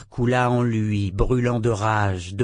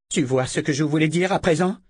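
cri moqueur; 6 semitones higher, faster than usual. A narrator's reading. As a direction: question furieuse, plus aiguë. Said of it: "cria Verna". (Verna crie sur Richard en le torturant)

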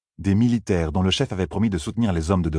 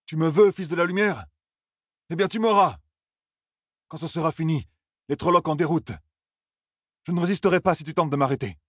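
much higher, challenging voice; defiant young leader; 10 semitones higher than usual. Acted. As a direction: dare the officer to come for you. Narrator voices Perrin in quarrel.

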